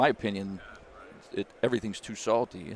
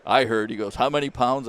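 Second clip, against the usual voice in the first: excited teasing; 3 semitones higher, 7 dB louder than usual. Retold or imitated. Golfer teasing about jerky orders.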